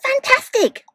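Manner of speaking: high, loud excited shout. Acted. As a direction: cheer it out at the top. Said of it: "Elizabeth shouted".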